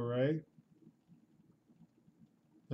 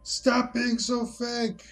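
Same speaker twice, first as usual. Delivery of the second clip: high, insistent complaint; mock exasperation; 9 semitones higher, 4 dB louder than usual. Scolding the video for being fake, mock exasperated. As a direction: scold the video in exaggerated protest.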